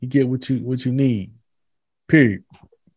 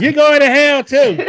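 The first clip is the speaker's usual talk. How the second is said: high, loud, slow mock damnation; damn them slowly, high and loud; mock preacher curse; 12 semitones higher, 5 dB louder, slower than usual. Joking curse.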